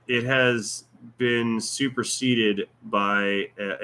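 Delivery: usual speaking voice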